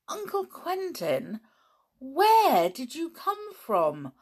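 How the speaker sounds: put-on curious boy. Dick voiced by reader.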